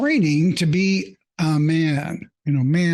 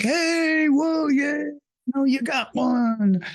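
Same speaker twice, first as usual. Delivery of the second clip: much higher, cheering hunter voice; excited hunting buddies; 10 semitones higher, slower than usual. Retold or imitated. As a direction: congratulate the kid on his first hit. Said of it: "And they're like".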